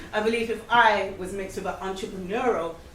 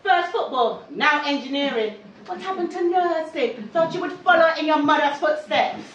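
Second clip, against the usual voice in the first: scolding mother, pitched up; mum voice; 5 semitones higher, 6 dB louder than usual. Retold or imitated. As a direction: nag like a disapproving mum. Mimicking mum's disapproval.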